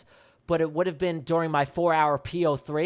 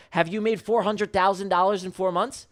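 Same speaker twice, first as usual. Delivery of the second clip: ordinary delivery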